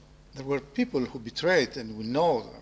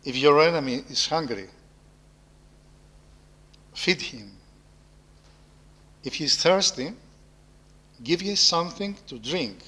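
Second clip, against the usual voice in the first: scripture reading. Acted. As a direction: read the verse solemnly, with pauses. Reads Paul's epistle to close.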